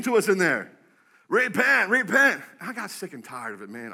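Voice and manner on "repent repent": loud, higher mock chant; hammed-up street-preacher call; megaphone street preacher. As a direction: imitate a protester calling through a megaphone. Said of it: "with these megaphones saying".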